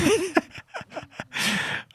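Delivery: high laugh; laughing along; real amused laugh. Real, not acted. Laughs at the joke.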